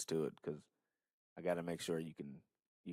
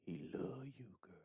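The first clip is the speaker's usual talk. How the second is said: whisper; 4 semitones higher, 10 dB quieter than usual. Pretend. Echoing cohost's shoutout.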